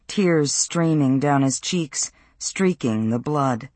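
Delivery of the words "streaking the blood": usual speaking voice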